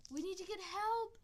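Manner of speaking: high, loud panicked cry for help; scream. A narrator's reading. Kit seeing badger attack her mother, high panicked scream. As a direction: scream it at the top of her lungs. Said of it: "Alpine Kit screamed".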